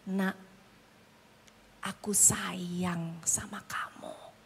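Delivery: hushed fatherly reassurance, each word drawn out low; tender. Retold or imitated. Jesus speaking to a sinful man as his child, tender whisper.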